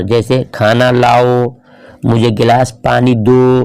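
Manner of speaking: ordinary delivery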